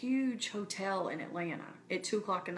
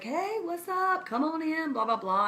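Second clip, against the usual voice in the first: lightly voiced friendly older man; jovial greeting; 8 semitones higher, 3 dB louder than usual. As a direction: greet her like a jolly concierge. Cheerful hotel man greeting.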